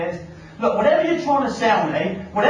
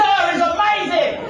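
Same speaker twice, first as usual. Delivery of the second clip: higher, slow, drawn-out declaration; emphatic mock retort; 5 semitones higher than usual. Traveller answers the airport salesman back, emphatic mock retort. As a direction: throw the sales greeting back with gusto.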